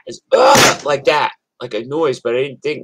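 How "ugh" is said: sudden high strangled groan, loud; pained groan imitated. Son imitating the noise he heard from the bathroom, high loud groan. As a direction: mimic the ugh noise, sharp and pitched high. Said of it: "a noise like that".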